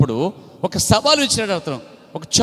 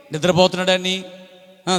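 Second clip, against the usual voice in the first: ordinary talk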